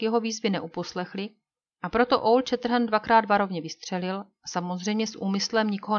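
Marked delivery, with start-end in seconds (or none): none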